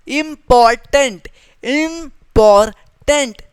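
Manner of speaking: इंपॉर्टेंट stretched slowly as im-por-tent, each of its three vowel sounds held; syllables drawn out. Shown as a demonstration. Teacher showing students the three vowel sounds in इंपॉर्टेंट, slowly. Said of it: "तीन vowel sound आ रहे हैं".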